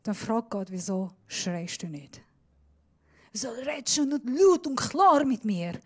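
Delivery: usual speaking voice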